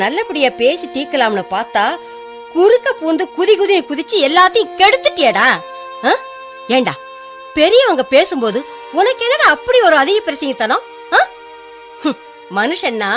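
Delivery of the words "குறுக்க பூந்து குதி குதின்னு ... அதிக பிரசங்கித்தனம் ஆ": exasperated mother telling off her son; higher-pitched, indignant reproach; angry scolding. Acted. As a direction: lay into him for meddling.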